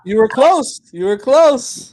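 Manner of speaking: high, loud exclamation; excited shout. Real, not acted. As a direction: call it out loudly, stretching the second "close". Teasing cheer over co-host's prediction.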